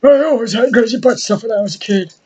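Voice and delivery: high put-on old-timer voice; mock old-timer voice. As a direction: brag it like a goofy old man. Mock reminiscing.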